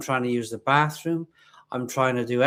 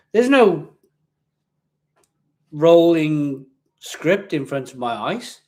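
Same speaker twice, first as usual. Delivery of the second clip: exasperated; 7 dB louder, slower than usual. Real, not acted. Exasperated defence.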